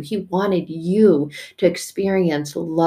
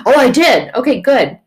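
louder, higher play-acted comeback; mock triumphant retort; 5 semitones higher, 7 dB louder than usual. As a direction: throw it back in mock celebration. Acted dinner quarrel.

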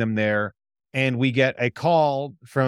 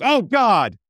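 high, loud burst of panic; mock-horrified yelp, pitched way up; alarmed cry; 10 semitones higher, 6 dB louder than usual. [name] learns Brad sees a gun, panicked loud cry.

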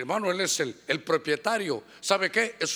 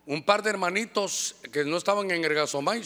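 ordinary delivery

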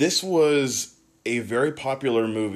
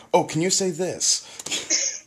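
higher, drawn-out mock pleading; eager requester; 7 semitones higher, much slower than usual. Host mimics himself pestering the British guest, mock eager. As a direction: stretch it out like an excited fan asking. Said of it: "if I just sit here going".

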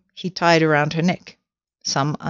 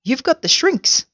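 high-pitched storybook cry; 11 semitones higher than usual. A narrator's reading. Mr Twit pointing like a pistol at his wife, crying out. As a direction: announce it high, like an accusation. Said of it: "cried Mister Twit".

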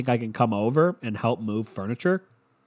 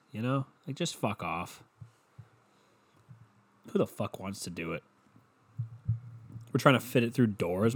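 normal talking voice

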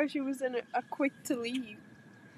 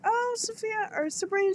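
tag; 8 semitones higher than usual. Retold or imitated. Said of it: "our mom was like".

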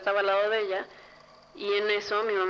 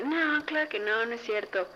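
dismissive denial; 4 semitones higher than usual. Retold or imitated. Dad told his parents are here, mock dismissive. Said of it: "mi papá primero como muy de".

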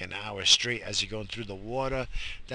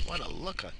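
usual speaking voice

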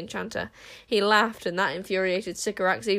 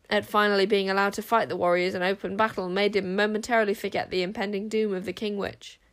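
plain reading voice

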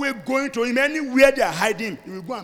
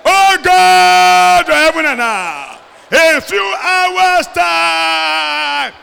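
shouted prayer, stretched and loud; preaching at full force; 5 semitones higher, 11 dB louder, slower than usual. Acted. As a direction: pray it out at full force over the crowd.

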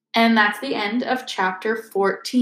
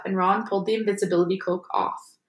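ordinary delivery, nothing marked

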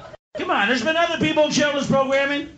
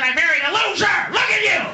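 nagging wife and husband; 6 semitones higher than usual. Retold or imitated. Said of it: "His wife probably gave him shit".

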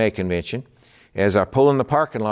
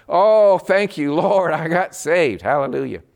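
imitated bawling; 7 semitones higher than usual. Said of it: "Oh, she was just bawling".

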